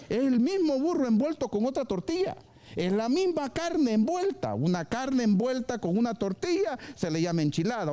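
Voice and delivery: normal talking voice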